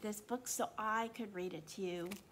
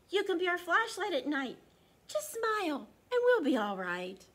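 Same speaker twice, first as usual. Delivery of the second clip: higher, louder mocking taunt; teasing kids; 7 semitones higher, 8 dB louder than usual. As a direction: taunt him playfully.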